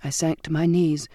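plain reading voice

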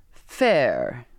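a touch of scorn as he repeats the word; derisive echo. Acted. Captain mocking the girl's protest, a light derisive echo. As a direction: throw her word back mockingly. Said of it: "his voice thick with derision".

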